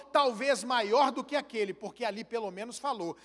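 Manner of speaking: ordinary delivery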